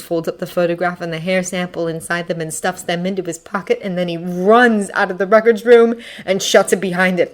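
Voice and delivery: usual speaking voice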